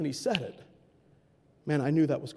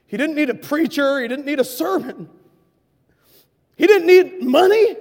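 louder and higher each line; building emphasis; 8 semitones higher, 10 dB louder than usual. Acted. Preacher driving the point home to congregation, rising loud. Sermon climax.

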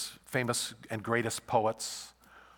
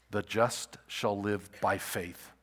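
slow emphatic verse; 2 semitones lower, slower than usual. Shown as a demonstration. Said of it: "repeated key verse".

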